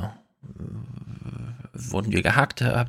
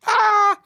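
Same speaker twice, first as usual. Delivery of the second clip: high shriek; 5 dB louder than usual. Acted. Sudden shriek mid podcast.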